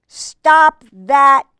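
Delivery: loud, slow alarmed cry. A narrator's reading. Birds crying out to stop the thug, loud and slow. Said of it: "cried the birds".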